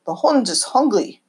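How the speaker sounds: a hint of an old German immigrant's accent. Grandpa feeding the dog under the table, lightly imitated German accent. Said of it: "in his German accent".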